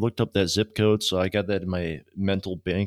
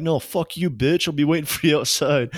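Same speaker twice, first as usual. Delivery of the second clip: raised-pitch mock defiance; heated, higher-voiced retort, retold for laughs; retold angry comeback; 7 semitones higher than usual. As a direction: fire the insult back, playing it up. Teller fires back, retold quarrel.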